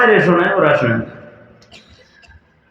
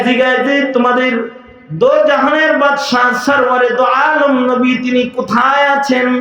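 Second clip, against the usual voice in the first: yearning cry; 8 semitones higher than usual. Acted. Pleading search for the Prophet.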